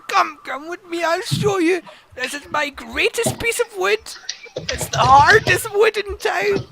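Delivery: put-on a proud old woodworker; old man voice. Woodworker shows his wood.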